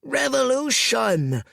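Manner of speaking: the lieutenant blurts out the news; panicked cry of revolution; cried out. A narrator's reading. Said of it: "cried Spiketail".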